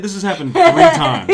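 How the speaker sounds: high, loud laugh through the words. Real, not acted. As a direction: laugh at your own slip-up. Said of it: "tonight I'm saying V's with, as a B sound".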